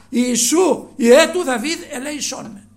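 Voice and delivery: loud pleading cry for mercy. A narrator's reading. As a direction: call out to Jesus with all your voice. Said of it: "άρχισε να φωνάζει δυνατά". (δυνατή ικετευτική κραυγή)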